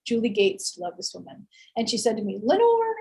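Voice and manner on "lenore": high Southern drawl on a name; a friend from Georgia greeting her in a Southern accent. Retold or imitated. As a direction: call the name like a Savannah friend, pitched up. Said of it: "in her Southern accent".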